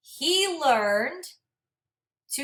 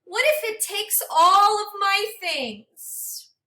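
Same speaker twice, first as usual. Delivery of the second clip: gentle, expressive storybook read-aloud; reading to children; 4 semitones higher than usual. Acted. Teacher reading a storybook to her class, expressive and gentle. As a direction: read it like a picture book for a class.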